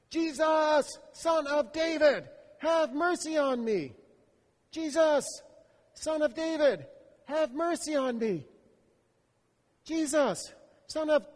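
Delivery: high, loud, drawn-out pleading cry; desperate cry. Acted. Blind man keeps crying to Jesus over the crowd.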